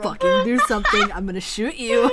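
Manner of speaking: loud mock demand. GM voicing frustrated impatience.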